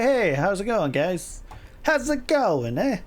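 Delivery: over-the-top hey guys; hammed-up greeting. Shown as a demonstration. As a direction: sing out a big friendly hello. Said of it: "No, no, no, no. You gotta say".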